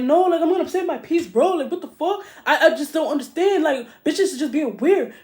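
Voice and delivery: high, loud worked-up imitation; hammed-up indignant outburst; mock angry rant. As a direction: rant in a high, exasperated mimicking voice. Acting out [name]'s angry rant.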